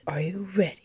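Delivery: hushed dramatic whisper. Pretend. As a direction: whisper it to build suspense. Whispered before quiz.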